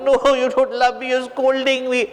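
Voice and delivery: high, whining complaint; mock tearful protest. Hanuman protests to Ram that he is scolded, whining. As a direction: protest like an upset child. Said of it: "Hanuman ji is crying".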